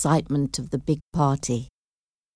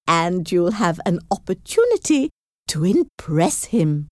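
animated, insistent fussy mother voice; scheming mother; 4 semitones higher than usual. Acted. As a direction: lay out the clever plan, pitch swooping up on key words. Mother scheming over daughter's visit.

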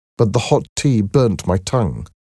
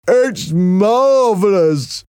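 high, slow, gentle-mannered Merrick voice; timid deformed man, high and slow; Merrick's voice; 11 semitones higher, 3 dB louder, much slower than usual. A narrator's reading. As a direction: voice the shy Merrick higher and slower than the narration.

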